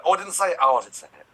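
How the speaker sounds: high, loud retold outburst; worked-up, high-pitched self-reproach; mock exasperation. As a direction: burst out high and loud at yourself. Retold exasperation at own verbal habit.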